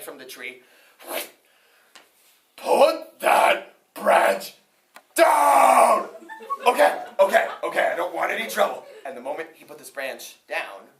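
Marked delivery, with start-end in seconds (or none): 2.6-6.1 slow build to a very high, loud shout; the tree roars at the farmer to drop the branch; tree yelling. Acted. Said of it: "the tree yelled at me". 6.7-8.8 nervous farmer backing off. Acted.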